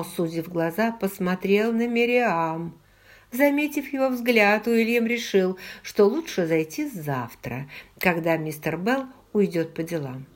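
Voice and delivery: plain reading voice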